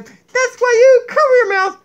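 high, lively puppet voice; Donkey puppet voice. Acted. Puppet Donkey lecturing viewers about cats, high puppet voice.

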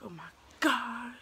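slow, breath-filled exclamation; imitated agonised half-whisper; retold pain. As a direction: draw it out as if hurting. Retold pain of first breastfeeding.

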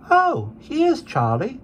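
put-on high, slow little boy voice; small boy. A narrator's reading. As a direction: call out like a small child. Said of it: "said the boy".

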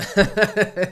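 high, loud laugh; laughing at grandma's cheek. Real, not acted. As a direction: let a real laugh burst out. Laughs at yaya's insult.

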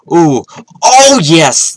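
loud, high whoop of triumph; excited cheer. Real, not acted. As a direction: celebrate the big win out loud. Big win in the game.

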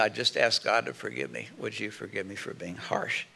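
sinking to a soft, hushed plea; a soft, humble request; humble asking. Retold or imitated. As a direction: ask quietly and humbly. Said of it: "Or I'd say".

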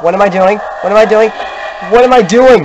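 from puzzled to frantic; building panic. Real, not acted. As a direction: panic climbs with each repeat. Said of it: "repeated, rising panic".

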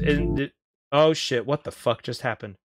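ordinary talk, nothing marked

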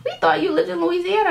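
very high, hurried mimicking; high-pitched mock client protest; imitated clients. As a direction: put on a squeaky-high correcting client. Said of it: "And like".

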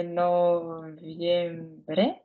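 нов'ємбре drawn out slowly, rising; slowly checking. Real, not acted. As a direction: stretch it while thinking. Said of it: "дайте мені провірити". (повільно, ніби перевіряючи)

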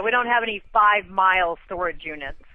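normal talking voice

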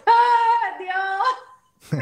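high, loud drawn-out gasp to God; hammed-up "oh God" outburst; dramatic exclamation. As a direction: cry out in exaggerated release. Acts out her relieved reaction.

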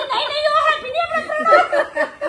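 high, loud, laughing protest; dramatic loud aunty. Retold or imitated. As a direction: shriek it out while laughing.

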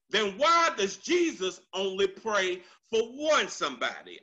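usual speaking voice

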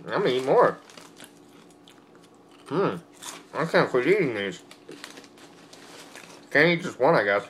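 ordinary talk

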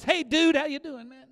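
high, chummy 'hey dude' voice; mock casual greeting. Preacher mocking a too-casual greeting to the Lord, mock-chummy. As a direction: hail God like a buddy, hammed-up. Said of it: "we're not going to say this".

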